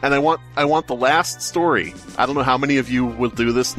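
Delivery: normal talking voice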